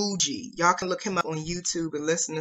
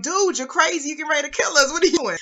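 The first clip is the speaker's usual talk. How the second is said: much higher, lightly acted yelling drivers; mock angry drivers; 8 semitones higher, faster than usual. Drivers honking at the swerving learner, a mild mock outburst. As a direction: toss off a hint of annoyed motorists. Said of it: "People are blowing at him like".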